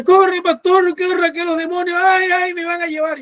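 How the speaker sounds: high-pitched cry, loud at the start; panicked cry. Retold or imitated. Dying boy begging the pastor to save him from demons, panicked cry. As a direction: imitate someone frantic with terror.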